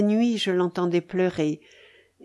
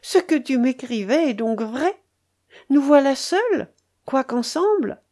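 higher-pitched pained questioning; raised voice of narrated heartache; sorrowful; 5 semitones higher, 3 dB louder than usual. A narrator's reading. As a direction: plead with a touch of anguish. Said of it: "dit-elle d'une voix douloureuse".

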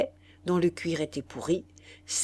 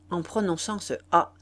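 usual speaking voice

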